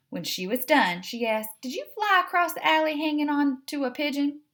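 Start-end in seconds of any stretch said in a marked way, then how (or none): 1.6-4.4 Southern Belle. Acted. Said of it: "we're gonna do the Southern Belle".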